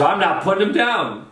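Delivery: high, hollered refusal; mock stubborn refusal. Charlie refuses to release his captive, mock stubborn refusal. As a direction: imitate a showman announcing he won't budge.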